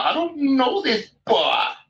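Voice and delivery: high, drawn-out mock complaint; mock aggravated baby. Teasing a friend stuck in a strange bar, mock aggravated baby. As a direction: tease him with a whiny baby's protest. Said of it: "you're a baby in the corner".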